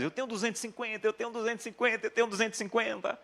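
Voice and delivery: put-on high, fast, giddy child chanting; excited little boy. Father imitating his son jumping for joy, high and fast. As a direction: bounce the words like a kid jumping around the house. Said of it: "Começou a pu-, pular dentro de casa".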